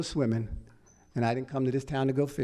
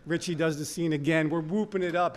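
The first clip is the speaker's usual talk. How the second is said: usual speaking voice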